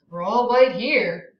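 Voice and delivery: usual speaking voice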